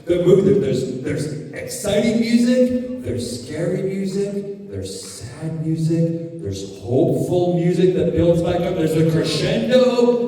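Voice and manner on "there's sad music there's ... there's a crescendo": ordinary delivery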